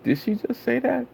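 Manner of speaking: high squeaky did-she-just-say-that; shocked disbelief. Retold or imitated. Streamer retelling his reaction to her intro, high and stunned. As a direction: jump way up in pitch in disbelief.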